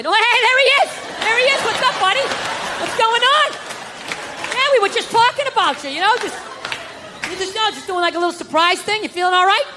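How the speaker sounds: high-pitched, over-friendly cover-up; fake cheery greeting. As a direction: greet him too brightly, pretending all is fine. Acts caught talking, greets him cheerily.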